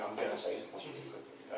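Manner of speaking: matter-of-fact notice ending down on "quickly"; said as a statement. Shown as a demonstration. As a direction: announce it, no rise on "quickly". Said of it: "It's like a statement".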